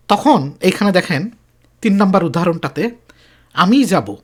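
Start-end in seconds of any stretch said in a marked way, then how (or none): 3.5-4.1 আমি said with a firm push; emphasis on আমি in "আমি যাব"; stress on আমি. Shown as a demonstration. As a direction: lean on আমি, a little louder. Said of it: "একটু জোর দিয়ে বলতেছি".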